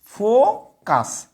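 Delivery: ফোকাস drawn out slowly and clearly; slow model pronunciation. Shown as a demonstration. Teacher showing the singular, slow and clear. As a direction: stretch ফোকাস for the class to copy. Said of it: "ধরেন যে ফোকাস".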